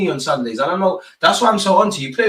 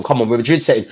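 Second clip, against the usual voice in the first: ordinary delivery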